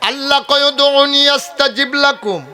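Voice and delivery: high, loud fervent sermon; full-force preaching. Acted. Preacher voicing God's promise to the crowd in a high, loud preaching cry. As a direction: call out each promise with fervour.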